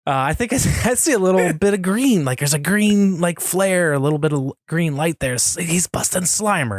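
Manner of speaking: excited retelling of a friend's sharp guess; a touch of mimicry of Brendan; quoting Brendan. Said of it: "And Brendan said".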